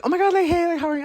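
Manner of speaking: very high-pitched excited mock greeting; gushing greeting. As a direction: squeal hello like an overfriendly acquaintance. Acting out acquaintance's effusive greeting.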